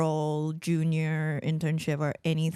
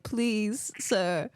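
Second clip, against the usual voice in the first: higher-pitched, hammed-up plea; mock begging; 5 semitones higher than usual. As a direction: beg playfully for a job.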